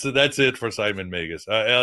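ordinary delivery

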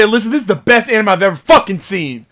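reenacted excited outburst; hyped raving. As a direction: insist on it, thrilled, to your partner. Retelling his hyped exclamation.

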